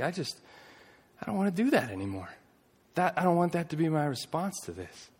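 soft, high, understated imitation of pleading through tears; retold tearful plea. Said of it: "through tears, just bawling".

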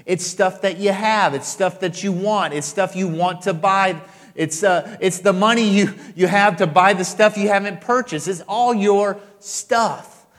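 loud, rising preaching, slowing at the end; building sermon climax; preaching at full force. Acted. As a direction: hammer the word stuff.

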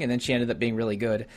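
ordinary talk, nothing marked

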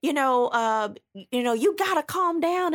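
lightly voiced, high imitation of him; mock him telling her to calm down; hint of a pleading boyfriend voice; boyfriend restraining her. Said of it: "So he was like".

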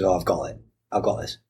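usual speaking voice

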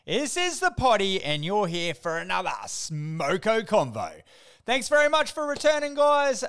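high, loud Aussie welcome; aussie tradesman. Acted. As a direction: greet the listeners like mates. Energetic welcome.

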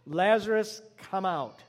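calling out loudly to the tomb; Jesus calling Lazarus from the tomb, loud call. Acted. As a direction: summon him with a loud voice. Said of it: "called out in a loud voice".

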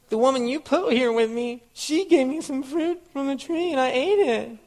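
mock-whine the blame-shifting husband; whiny voice. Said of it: "in a whiny voice".

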